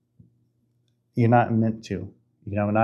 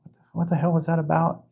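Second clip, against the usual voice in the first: higher, puzzled mutter to yourself; half-asleep confusion, voiced higher; bewildered waking thought; 7 semitones higher than usual. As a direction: play a groggy what-was-that. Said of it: "you're like".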